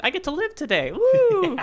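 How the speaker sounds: high, gleeful whoop; Luffy finding the mask, gleefully high; delighted surprise. Retold or imitated. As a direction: squeal with joy at being alive. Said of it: "pleasantly surprised".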